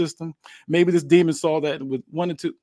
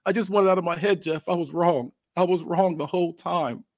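high-pitched, pleading, acted crying; tearful apology; 7 semitones higher than usual. Wrestler in pain begs Jeff's forgiveness, tearful pleading. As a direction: beg forgiveness in a pained, raised voice. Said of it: "he starts crying and says".

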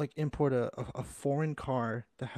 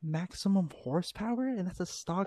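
rising amazed emphasis; excited disbelief; 6 semitones higher than usual. Real, not acted. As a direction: marvel at the number. Amazed at horsepower.

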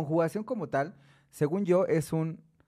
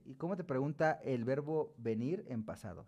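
ordinary talk

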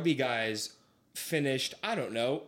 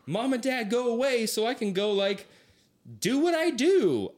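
put-on higher, eager teen voice shooing off his parents; 8 semitones higher than usual. Son at college move-in telling parents leave, eager higher voice. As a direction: wave the folks off, bursting to be free.

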